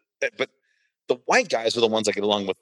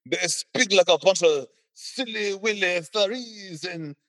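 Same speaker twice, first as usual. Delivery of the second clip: higher, slow mock Quebecer voice, lightly put on; 7 semitones higher, slower than usual. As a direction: make fun of the Parisians as a smug Quebecer, up high and slow. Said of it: "the Quebecians are like".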